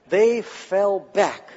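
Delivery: slow, weighted words; drawn-out emphasis. Real, not acted. Preacher tells the arresters' fall, drawn-out emphasis. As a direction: stretch each word for dramatic effect. Dramatic answer after pause.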